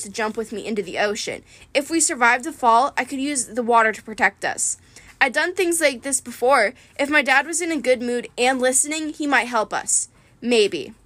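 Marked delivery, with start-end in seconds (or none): none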